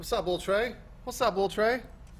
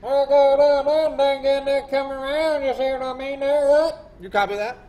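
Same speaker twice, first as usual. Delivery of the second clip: high, loud garbled radio voice; mock pilot radio chatter; 7 semitones higher, 8 dB louder, slower than usual.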